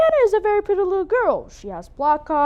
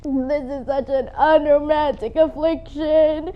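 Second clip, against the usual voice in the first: tearful; 3 semitones lower, slower than usual. Acted. Weeping over her hair.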